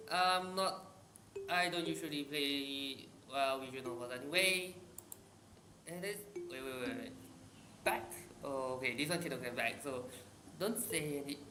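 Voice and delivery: ordinary delivery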